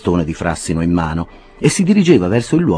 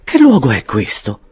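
Mrs Bardell uneasy; 7 semitones higher, 5 dB louder than usual. A narrator's reading. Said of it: "disse la signora Bardell fermandosi".

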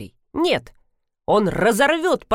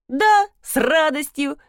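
high, over-eager agreement; mildly forced eagerness, pitched up; blurted out; 6 semitones higher, slower than usual. A narrator's reading. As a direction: blurt it like a drilled answer. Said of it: "выпалила я".

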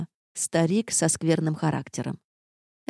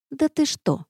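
high-pitched mock amazement; surprised; 10 semitones higher than usual. A narrator's reading. Narrator challenges creature's denial, surprised. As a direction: react in pointed disbelief. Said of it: "удивилась я".

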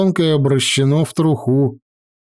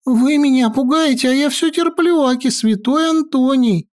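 much higher, plaintive whine; whining wizard voice; 11 semitones higher than usual. Acted. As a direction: complain like a martyred saint. Maestro complains, higher.

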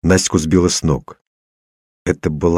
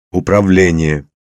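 loud, carrying command word; trial command; 5 dB louder than usual. A narrator's reading. Man tries a control word at random, commanding. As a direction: call it out as an order. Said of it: "Попробовал я наугад".

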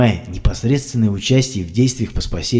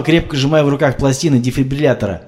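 usual speaking voice

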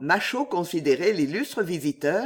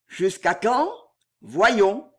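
terrible calm; 2 semitones higher, 3 dB louder, much slower than usual. A narrator's reading. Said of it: "avec un calme terrible".

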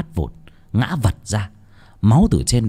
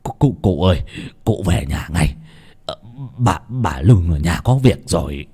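out of breath; 5 semitones lower than usual. Acted. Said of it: "thở hồng hộc".

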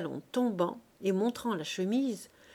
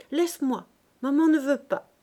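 higher, louder, huffy protest; cross little girl; 6 semitones higher, 7 dB louder than usual. A narrator's reading. Prim little girl refusing a scruffy boy, cross. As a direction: push the boy away with a cross voice. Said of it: "en murmurant d'un ton fâché".

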